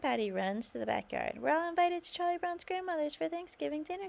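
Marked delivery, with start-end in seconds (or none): 1.4-4.1 high, thrilled announcement; bursting with good news; excited cry. A narrator's reading. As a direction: call the happy news out, higher. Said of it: "she cries".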